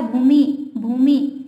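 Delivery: भूमि ending on a short "i"; short i in bhumi. Shown as a demonstration. Teacher showing the correct short i in भूमि. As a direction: end भूमि on a short crisp i. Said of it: "छोटी की मात्रा".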